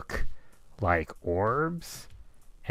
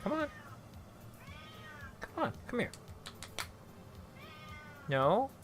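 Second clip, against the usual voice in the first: much higher sing-song coaxing voice; coaxing pet talk; 13 semitones higher, 8 dB quieter, faster than usual. Real, not acted. Owner coaxing her shy cat toward the camera, high cooing pet talk.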